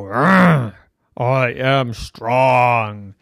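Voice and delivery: slow growled I am strong; power pose growl. Acted. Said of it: "you go".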